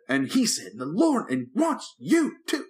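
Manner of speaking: high, slow, fervent preacher impression; mock televangelist proclaiming, pitched high and drawn out. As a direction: parody a pastor saying something empty with passion. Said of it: "major preacher voice".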